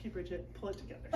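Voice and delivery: calm yourself down under your breath; muttered self-reassurance; quiet self-talk. Retold or imitated. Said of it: "I'm like".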